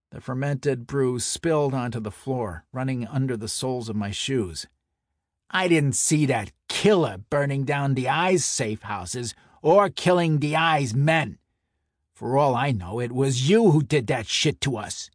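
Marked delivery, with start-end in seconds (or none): 5.5-15.1 angry shout. Acted. Santoni yelling accusation.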